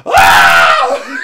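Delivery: loud laughing shout; excited scream. Retold or imitated. Fans reacting to the teaser, loud excited scream. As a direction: scream with glee. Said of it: "everyone was like".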